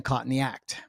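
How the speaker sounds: lightly Australian-accented, dropping the r; a hint of an Australian accent. Shown as a demonstration. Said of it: "with an Australian accent".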